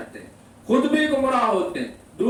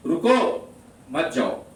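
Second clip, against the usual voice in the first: رکو on its own, pause, then lower مت جاؤ; pause after رکو; 5 semitones lower than usual. Shown as a demonstration. Preacher showing listeners two meanings, pause after رکو. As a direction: break after رکو, keep مت جاؤ together. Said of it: "ایک ہی بات دو مفہوم".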